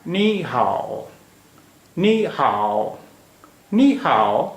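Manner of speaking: slow नी हाओ, each word drawn out; Mandarin tone demonstration. Shown as a demonstration. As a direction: say नी हाओ slowly with exaggerated tones. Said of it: "ऊपर से नीचे ही आवाज़ आ रही".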